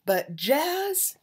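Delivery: ordinary delivery, nothing marked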